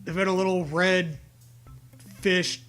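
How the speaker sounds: ordinary talk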